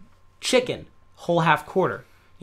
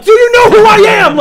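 much higher and louder, fast; mock panicked shout; 14 semitones higher, 14 dB louder, faster than usual. Lost tourist grabbing locals, mock frantic shout. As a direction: yell it, grabbing strangers.

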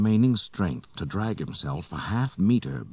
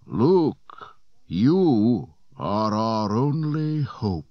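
very slow, higher and louder spectral voice; slow, higher ghostly appeal; ghostly plea; 5 semitones higher, 4 dB louder, much slower than usual. A narrator's reading. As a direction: plead from beyond, slowly. Ghost's parting words.

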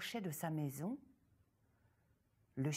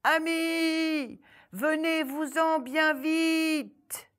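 high, loud, drawn-out call for help; girl calling the hares from the tree, loud and high; 9 semitones higher, 11 dB louder, slower than usual. Acted. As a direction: shout to friends far away. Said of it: "en entendant les cris".

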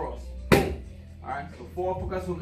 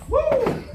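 loud high woo; hype cheer; 8 dB louder than usual. Real, not acted. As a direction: whoop to fire them up. Coach cheers on the fighter.

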